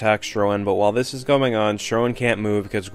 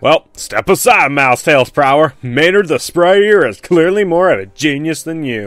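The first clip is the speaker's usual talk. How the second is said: louder, slow hammed-up boast; mock smug brag; 4 semitones higher, 5 dB louder than usual. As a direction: gloat over the rival like a cartoon show-off. Mocking a bragging character.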